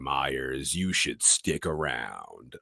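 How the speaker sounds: solemn farewell, drawn out low; Hellboy. Acted. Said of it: "Hellboy puts down Liz and tells Myers".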